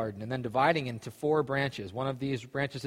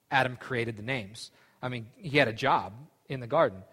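usual speaking voice

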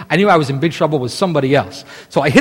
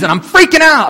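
panicky; 11 semitones higher, 6 dB louder than usual. Retold or imitated. Panicked retelling of the dream.

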